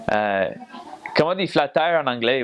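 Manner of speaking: quick Quebec French with English "flat tire" inside; a confused Quebecer asking; puzzled Quebecer. Retold or imitated. A Quebecer asks how to say flat tire, lightly puzzled Quebec accent. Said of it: "somebody coming up and saying".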